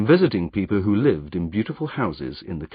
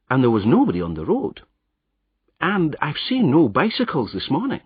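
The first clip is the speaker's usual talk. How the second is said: a higher, lightly done Scottish roadman; Scottish accent; 4 semitones higher than usual. Acted. Disguised hero explains his boots in a Scottish accent. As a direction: keep the Scottish disguise going, casually.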